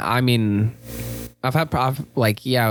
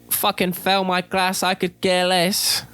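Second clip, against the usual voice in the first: much higher, slowing mock of a teacher who doesn't care; mock bitter teacher; 7 semitones higher than usual. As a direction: sneer it as a burnt-out teacher. Imagined uncaring teacher voiced.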